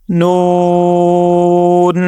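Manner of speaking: نون with a very long oo, closed on n; stretched noon. Shown as a demonstration. Teacher drilling the word's ending, نون stretched out long. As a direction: hold the oo of نون, then sound n. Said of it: "نو کو ہم نے stretch بھی".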